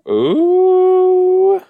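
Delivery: loud, very high sustained "ooh"; high drawn-out ooh. Real, not acted. Viewer reacting to the show, high excited ooh. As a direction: squeal out a long, high ooh.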